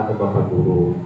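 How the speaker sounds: usual speaking voice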